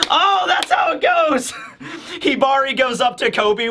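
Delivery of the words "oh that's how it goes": high-pitched, through real laughter; giddy and high; cracking up. Real, not acted. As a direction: exclaim while recovering from a laughing fit. Laughing fit over imagined scene.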